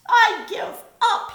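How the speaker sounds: a big bear bawling in defeat; blubbering. A narrator's reading. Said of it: "he blubbered".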